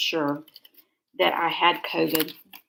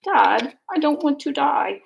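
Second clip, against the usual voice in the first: put-on high, louder little English child's voice; pitiful little girl's English accent, pitched up; cute English accent; 8 semitones higher, 6 dB louder than usual. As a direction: imitate a small English girl pleading with her dad. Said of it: "in a cute little English accent".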